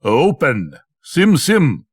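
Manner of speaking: lightly voiced robber captain calling out, rising high on Sim; a hint of a commanding captain ordering the rock; captain's command. Robber captain ordering the rock door open, lightly commanding.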